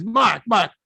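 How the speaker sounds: high, exasperated Brooklyn accent impression; Larry King. Dead mentor begging Mark for peace, exasperated Brooklyn accent. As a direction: brush him off in irritated comic Brooklynese. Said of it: "in his Brooklyn accent".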